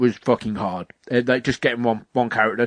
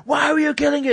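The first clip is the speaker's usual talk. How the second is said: high-pitched shrieking telling-off; hammed-up scandalised yelling; going mental. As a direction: imitate an outraged witch, voice right up. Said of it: "she goes mental".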